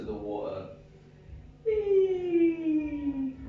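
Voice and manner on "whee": high squealed whee; a falling man's delighted high whee; gleeful whee. Acted. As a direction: squeal it like a kid on a slide. Acting out man falling overboard.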